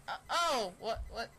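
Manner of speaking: normal talking voice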